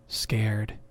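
usual speaking voice

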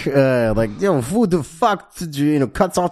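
badly faked Russian slipping into Sopranos American; Sopranos accent. Host mocking Gorky Park's accents, hammed-up Sopranos voice. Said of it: "turns into, like, The Sopranos".

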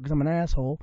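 normal talking voice

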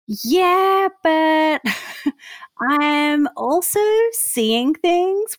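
high, slowed-down confession with a laugh; sheepish admission. Retold or imitated. Guest retelling her reply to the counselor. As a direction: draw it out, half embarrassed.

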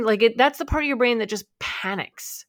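ordinary delivery

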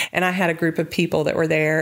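usual speaking voice

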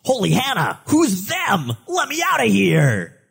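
high, shouting, frightened cabbie; panicked cabbie. Acted. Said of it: "Then the cabbie says".

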